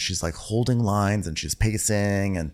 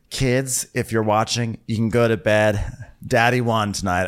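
usual speaking voice

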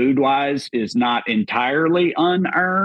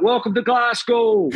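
higher, slower, called-out greeting; mock yell; 10 semitones higher, slower than usual. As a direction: bellow it across the street at the tourists. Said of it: "he just yells out".

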